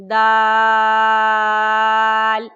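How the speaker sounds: دال held very long and loud; long drawn dal. Shown as a demonstration. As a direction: stretch دال four to five counts. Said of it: "چار سے پانچ علی کے برابر لمبا کریں گے".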